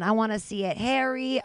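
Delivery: ordinary talk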